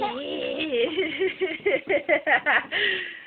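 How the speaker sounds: real happy laughter; warm laugh. Real, not acted. Laughing with baby. (risada gostosa)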